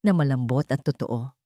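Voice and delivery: usual speaking voice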